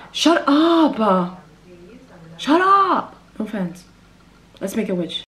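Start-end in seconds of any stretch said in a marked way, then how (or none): 0.1-3.1 loud high mock yell; shouting at a friend in jest; playful shout. Real, not acted. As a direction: yell it, half joking. Yelling at someone.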